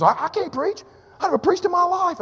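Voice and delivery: high-pitched, stammered mock alarm; panicked protest. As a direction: imitate a flustered helper balking in a high voice. Retold youth worker balking at preaching.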